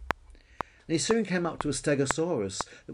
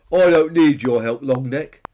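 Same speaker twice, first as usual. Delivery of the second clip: loud, scornful rebuff; dismissive; 3 semitones higher, 13 dB louder than usual. A narrator's reading. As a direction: push the helper away with volume. Peter rebuffs Billy.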